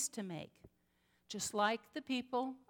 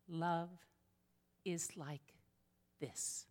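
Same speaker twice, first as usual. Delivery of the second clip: tender quote; 4 semitones lower, 7 dB quieter, slower than usual. Retold or imitated. Quoting her signed notes gently.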